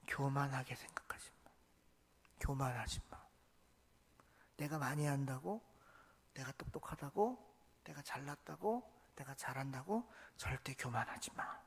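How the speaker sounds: soft, low, earnest warning; hushed warning. Acted. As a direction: lean in and warn them quietly. Pastor softly urges congregation. (성도들에게 낮고 조용한 경고)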